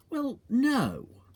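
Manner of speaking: put-on high young boy's voice; lightly voiced boy Danny; child's voice. A narrator's reading. Danny voiced in read story.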